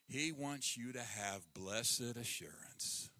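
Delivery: slow hushed promise; slow, soft whisper. Real, not acted. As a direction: lean in and whisper it. Intimate whisper to congregation.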